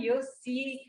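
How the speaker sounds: ordinary talk, nothing marked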